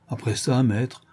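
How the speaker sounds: plain reading voice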